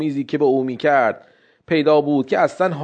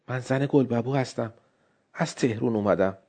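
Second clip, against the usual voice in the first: soft, timid wife; 3 semitones lower, 9 dB quieter than usual. Acted. Wife explains herself at the door.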